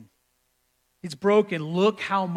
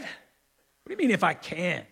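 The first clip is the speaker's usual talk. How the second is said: incredulous Jesus. Acted. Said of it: "can you imagine Jesus like".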